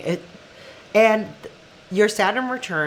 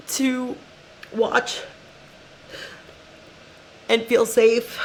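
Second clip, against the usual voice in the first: real crying voice, high with long breaks; tearful; 6 semitones higher than usual. Real, not acted. Podcaster wanting listeners to feel safe, tearful. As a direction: struggle through tears to finish the thought. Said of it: "there are days where I'm like this".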